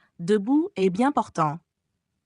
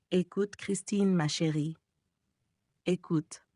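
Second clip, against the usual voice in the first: soft coaxing; 6 dB quieter than usual. Acted. Eric coaxing Christine softly.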